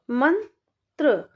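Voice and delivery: मंत्र stretched slowly, nasal न drawn out; slow sounding out. Shown as a demonstration. Teacher stretching मंत्र slowly for children. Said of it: "तो कैसे पढ़ेंगे?".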